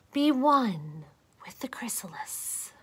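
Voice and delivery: soft unvoiced calm whisper; meditative whisper. Caterpillar whispers a calming mantra to himself. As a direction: breathe it out like a mantra.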